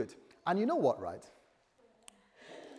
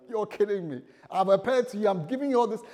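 higher, louder mock-angry God; exasperated, angry retort; 7 semitones higher, 8 dB louder than usual. God fed up with Moses for refusing, angry retort. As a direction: retell it as indignant, incredulous complaint. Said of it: "God was a bit angry with him".

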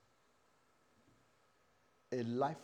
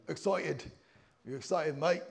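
mock West London mate-talk; West London accent; 2 semitones higher, 4 dB louder than usual. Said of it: "like they say in West London".

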